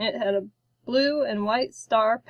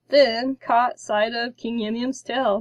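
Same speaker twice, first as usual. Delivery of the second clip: ordinary delivery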